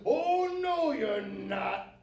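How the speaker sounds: high, loud, drawn-out refusal; big troll bellow, swooping down from high; defiant troll. A narrator's reading. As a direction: shout it slowly as an outraged troll.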